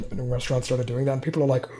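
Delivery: normal talking voice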